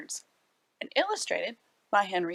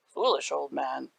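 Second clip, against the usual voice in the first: scoffing; 7 semitones lower than usual. Acted. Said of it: "scoffed young Tom".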